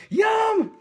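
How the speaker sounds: squeaky high yum, cracking up with laughter; laughing mock yum. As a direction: mimic your meek reply through giggles. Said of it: "I'm going".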